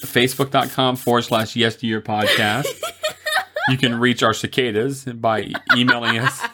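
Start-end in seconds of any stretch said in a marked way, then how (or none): none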